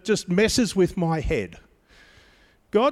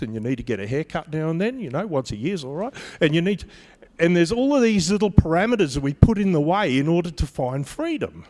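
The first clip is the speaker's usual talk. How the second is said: normal talking voice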